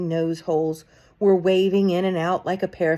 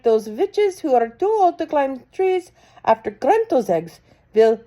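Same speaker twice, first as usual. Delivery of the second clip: a hint of a commanding witch, higher; lightly voiced witch; 7 semitones higher, 6 dB louder than usual. A narrator's reading. Grand High Witch instructs the witches, lightly commanding. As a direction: give orders like a bossy witch queen.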